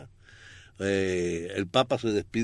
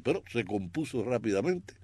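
normal talking voice

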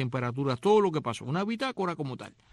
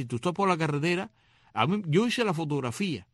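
ordinary talk, nothing marked

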